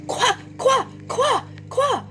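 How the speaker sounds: high, punchy short syllables; energetic high chirped chant; quick. Shown as a demonstration. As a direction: fire them out high and snappy. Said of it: "We're gonna do quick".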